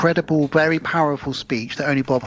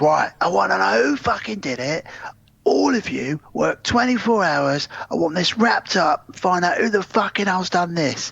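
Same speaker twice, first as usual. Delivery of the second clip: mock angry boss; 5 semitones higher than usual.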